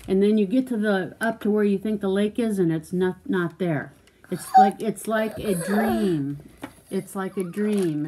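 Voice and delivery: normal talking voice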